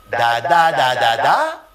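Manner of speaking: loud even da beats; rhythm syllables. Shown as a demonstration. Teacher chants the question rhythm on da. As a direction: beat it out on da. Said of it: "La dernière syllabe du groupe rythmique est plus longue".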